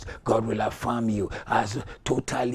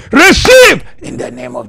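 high, explosive preacher's command; shouted command; 12 semitones higher, 18 dB louder than usual. Real, not acted. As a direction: fire the word out at full force. Commanding congregation to receive.

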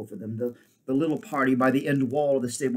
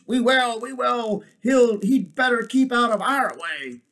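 high, very loud angry clamour; growling beasts; 12 semitones higher, 8 dB louder than usual. A narrator's reading. Beasts threatening the donkey, growling loudly. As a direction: shout it as a mob of beasts. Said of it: "growled the beasts".

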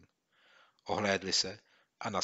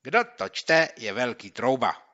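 louder, higher, slowing on the insult; reading the mocking note; 3 semitones higher, 9 dB louder, slower than usual. Boy reading the prank letter aloud, mocking. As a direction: read out the taunt.

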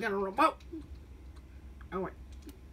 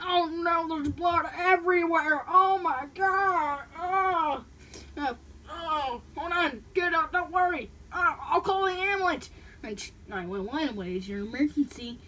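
panicked; 5 semitones higher, 5 dB louder, slower than usual. Acted. Blood, calling ambulance.

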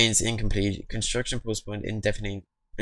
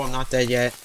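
normal talking voice

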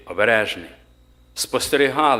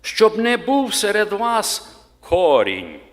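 slow, higher, louder reading of the Bible verse; solemn scripture reading; 3 semitones higher, 4 dB louder than usual. Acted. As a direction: read it out like a preacher proclaiming the Word, slowly. (урочисте читання Писання)

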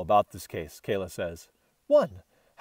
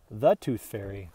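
ordinary delivery, nothing marked

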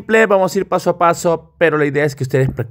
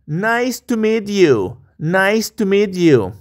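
slow model line; 3 semitones higher, much slower than usual. Shown as a demonstration. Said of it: "slow model for repetition".

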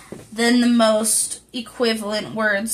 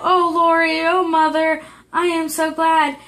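joyful cry; 5 semitones higher than usual. Acted. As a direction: burst out with sudden joy. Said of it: "crying out".

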